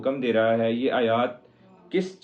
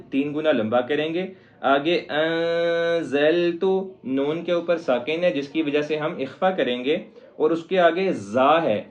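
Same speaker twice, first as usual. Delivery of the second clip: ordinary talk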